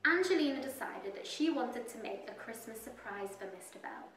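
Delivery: ordinary delivery, nothing marked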